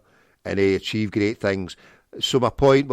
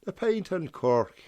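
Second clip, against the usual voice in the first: high, slow mock Irish; poor Irish accent; 8 semitones higher, slower than usual. Calling out the pub name, poor Irish accent. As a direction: stretch it out in a bad Irish brogue. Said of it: "Sorry for my poor Irish accent".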